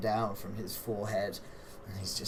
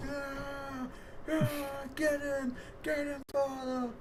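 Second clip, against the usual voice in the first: cheering crowd; 4 dB quieter than usual. Acted. Game master as the crowd urging Father on, muted high cheering. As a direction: squeal it like far-off fans egging him on. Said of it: "everyone in the crowd's like".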